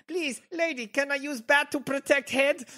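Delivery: high put-on accent; broken-English accent. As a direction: play a silly foreigner. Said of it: "accent humor".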